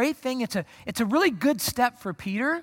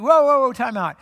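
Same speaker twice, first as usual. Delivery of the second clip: loud, high call for a halt; mock-alarmed, raised-voice cutting in; acted interruption; 4 semitones higher, 9 dB louder than usual. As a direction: burst in to stop him. Peter cutting in on Jesus.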